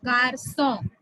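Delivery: "Garçon" with the c turned to s; soft c as s. Shown as a demonstration. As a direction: say "Garçon" as gar-SON, not gar-KON. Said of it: "k is changing to s".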